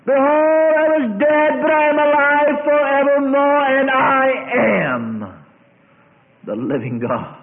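loud, high, commanding divine voice; risen Jesus proclaiming. Acted. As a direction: boom it like the risen Lord, slowing at the end.

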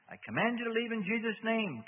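high, loud, sustained order; commanding. A narrator's reading. Exorcist orders demon out in Jesus' name, commanding. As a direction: drive it out with authority.

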